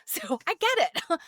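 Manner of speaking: a real giggle as she owns up; laughing. Real, not acted. Lecturer admitting she loves shoes too, laughing.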